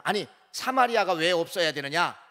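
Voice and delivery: usual speaking voice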